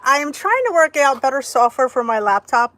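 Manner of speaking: ordinary talk, nothing marked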